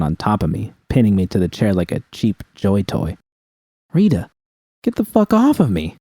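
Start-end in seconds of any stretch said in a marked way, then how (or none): 3.9-6.0 high, urgent protest. Acted. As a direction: try to shove her off, voice jumping higher. Pinned down, protesting.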